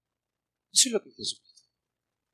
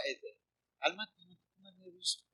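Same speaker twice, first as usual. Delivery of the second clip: soft calming words; soothing him; 14 dB quieter than usual. Pretend. Preacher calming an angry professor, much softer. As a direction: hush him gently to calm him.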